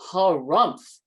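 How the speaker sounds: loud grumbling harumph; bear harumph. A narrator's reading. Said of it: "he said".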